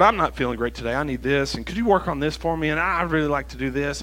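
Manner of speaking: quick, run-on list of requests; hurried mock prayer with no pauses; perfunctory rushed prayer. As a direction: rattle through it as a routine chore. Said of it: "It's so often that I go".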